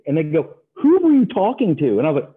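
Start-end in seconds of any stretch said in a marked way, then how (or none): 0.7-2.0 incredulous mock yell. Traders asking the newcomer who called, incredulous mock yell. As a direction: voice shot up high, louder.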